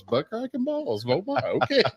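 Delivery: put-on a mother repeating her kid's words, half laughing; amused mom. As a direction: mom answering her toddler from the front seat, high and soft, taken aback. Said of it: "And she goes".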